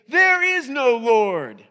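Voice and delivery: slow, loud, taunting; mocking shout. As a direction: shout it across at the neighbor. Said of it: "he'd get on his porch and he'd say".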